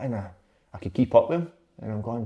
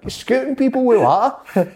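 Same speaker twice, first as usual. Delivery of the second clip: laughing; 13 semitones higher, 6 dB louder than usual. Real, not acted. Laughing at the prank memory.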